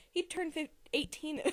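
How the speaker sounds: usual speaking voice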